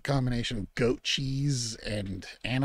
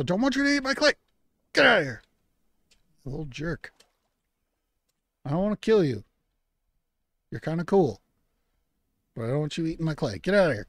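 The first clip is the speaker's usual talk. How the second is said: shooing yell; 5 semitones higher, 5 dB louder, faster than usual. Acted. Shooing the catfish away.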